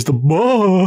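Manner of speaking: lightly voiced Hank Hill grunt; a short Texan bah. Shown as a demonstration. Showing the Hank Hill noise, a light Texan bah. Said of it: "mostly just the noise".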